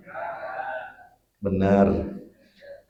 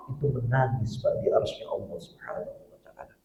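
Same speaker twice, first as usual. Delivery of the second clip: sudden near-whisper, much softer; hushed; 11 dB quieter than usual. Acted. Preacher hushing the congregation after the climax, whispered. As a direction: drop the voice to a breath.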